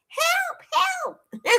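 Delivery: tiny, very high squeal for rescue; mock cry for help. Streamer begs chat for tech rescue, mock squealing.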